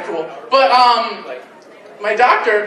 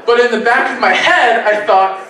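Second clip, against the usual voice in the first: usual speaking voice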